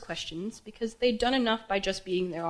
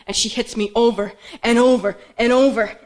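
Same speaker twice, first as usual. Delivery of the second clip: loud cry, hammering each repeated word; anguished shouting; 3 semitones higher, 10 dB louder than usual. Acted. Daughter reliving her mother hitting her, anguished shout. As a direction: shout it as the blows land.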